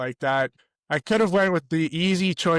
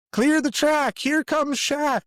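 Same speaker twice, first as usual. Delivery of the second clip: high-pitched imitation of a squeaky schoolkid; squeaky grade-four voice; 12 semitones higher, slower than usual. Host recalls opening his school speech on Eddie Shack, squeaky kid voice. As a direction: squeak it out high and drawn-out, like a child's speech opener. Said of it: "in this squeaky grade four voice".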